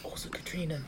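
whispering. Real, not acted. Aside to a friend.

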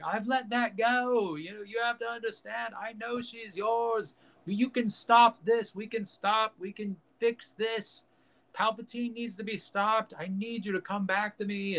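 high, drawn-out dramatic pleading; Obi-Wan. Retold or imitated. Obi-Wan begs Anakin to come back, high acted pleading. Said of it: "he's like".

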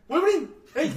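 put-on rowdy kids shouting the nickname; mocking call-out. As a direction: call him by the nickname to rile him up. Table of kids calling the waiter.

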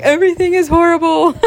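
high, hurried mock wail; pity-party whine. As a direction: exaggerate your own woe, half laughing. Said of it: "even in my pity party".

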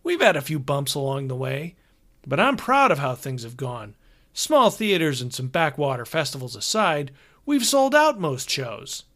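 brash, cheerful tour manager; hearty road manager. Acted. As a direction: play the chummy older road manager. Hogarth voice again.

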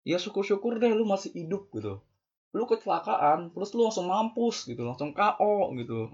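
high-pitched worked-up warning; rising alarm about the crash; heated warning. Real, not acted. (peringatan bernada tinggi)

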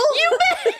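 high giggling yes; laughing. Real, not acted. Laughing while answering.

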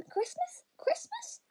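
very high, soft, wondering little rabbit voice. A narrator's reading. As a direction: squeak the word out like a curious little bunny. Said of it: "thought Lettuce".

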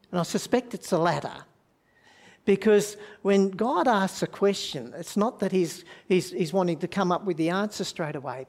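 ordinary talk

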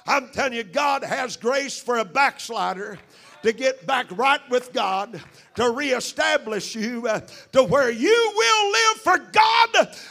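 loud, driving revival preaching that surges to a shout; full-force preaching. Real, not acted. Preacher urging backsliders to get right with God, full-force preaching. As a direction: preach it hard, pushing each phrase out loud.

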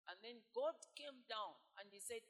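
ordinary talk, nothing marked

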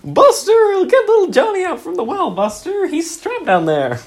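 very high, loud, fretful mock voice; silly character voice. Playing along, begging Buster in a very high loud squawk. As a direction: squawk it in a panic. Improv game.

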